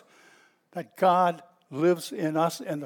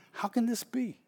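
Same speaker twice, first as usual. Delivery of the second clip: softly amazed, pitched higher; hushed, higher-pitched disbelief; 5 semitones higher, 7 dB quieter than usual. Acted. Bystanders amazed to hear their own languages, amazed. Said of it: "completely amazed".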